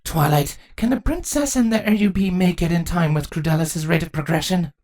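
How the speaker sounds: high, controlled queen's voice; high-pitched regal concern. Acted. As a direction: ask the daughter gravely. Queen asks her daughter.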